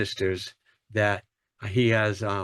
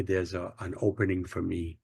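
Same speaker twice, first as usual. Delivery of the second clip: usual speaking voice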